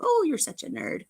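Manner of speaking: put-on high sitcom teen voice, tossed off; mock teen tease. A girl teasing like on Happy Days, high and mock. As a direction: play a fifties teen teasing a friend.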